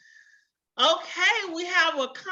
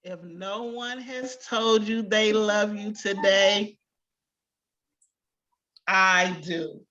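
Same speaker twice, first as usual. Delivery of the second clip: slow, low, tender "If no one has told you they love you today, I do"; tender affirmation; 4 semitones lower than usual. Real, not acted. Teacher telling students she loves them, slow and tender. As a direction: say "I do" gently, lower and slower. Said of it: "y'all said it with me".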